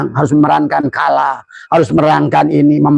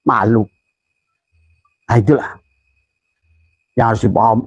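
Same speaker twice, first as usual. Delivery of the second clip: usual speaking voice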